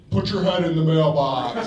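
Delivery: lightly put-on romantic voice; deep romantic voice. Man tells woman to use mailbox, mock romantic. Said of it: "in a deep romantic voice".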